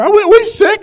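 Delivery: high, indignant squeak of a question; high mock-offended guest; offended tax collector. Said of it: "he's got his buddies around there".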